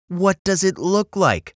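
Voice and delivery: mildly snappy grumpy bird; irritated snap. A narrator's reading. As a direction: snap back with rising irritation. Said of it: "snapped Bird".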